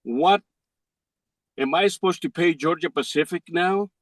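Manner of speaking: usual speaking voice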